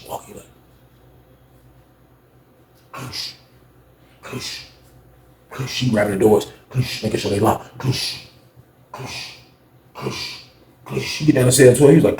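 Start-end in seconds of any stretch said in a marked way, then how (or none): 2.9-5.7 soft, short "kush"; hushed sound effect of doors being tugged; imitated door-check sound. 7.8-11.0 soft, short "kush"; hushed sound effect of doors being tugged; imitated door-check sound.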